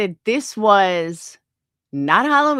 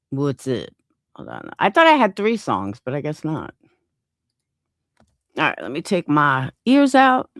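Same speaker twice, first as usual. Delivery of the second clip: normal talking voice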